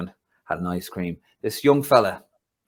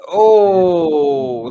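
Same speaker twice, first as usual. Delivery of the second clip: loud dismayed oh, high and sudden; a loud, high groan of dismay at bad news; oh, that hurts; pained loud oh; 9 semitones higher, 13 dB louder than usual. Real, not acted. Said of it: "his tone has changed".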